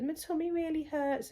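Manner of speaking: put-on high, plaintive child's complaint; whiny child. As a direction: imitate the daughter complaining of a tummy ache. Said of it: "she goes".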